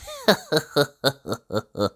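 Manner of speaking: high sly chuckle. Acted. Mister Brush chuckling at his trick, high and sly. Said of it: "Mister Brush chuckled to himself".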